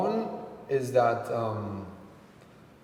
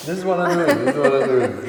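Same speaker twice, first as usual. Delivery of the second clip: laughing through it, louder second time; 2 semitones higher, 9 dB louder than usual. Real, not acted. Singer laughing as she agrees with the host. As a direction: chuckle the words out. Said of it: "laughing repeat".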